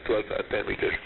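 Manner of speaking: ordinary talk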